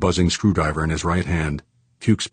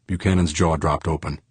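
plain reading voice

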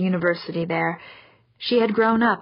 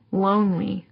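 ordinary delivery

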